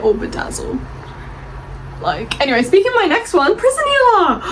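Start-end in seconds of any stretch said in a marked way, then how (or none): 2.6-4.4 high, bursting announcement of the title; excited reveal. Real, not acted. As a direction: squeal the title out, thrilled. Gushing intro of favourite book.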